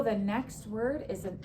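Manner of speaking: normal talking voice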